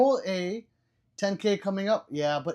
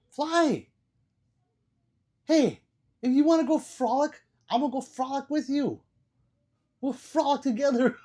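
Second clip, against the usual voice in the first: higher, louder, delighted call; high excited hail; excited greeting; 10 semitones higher, 6 dB louder than usual. Real, not acted. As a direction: greet a favourite viewer with glee. Greeting viewer Fly on stream.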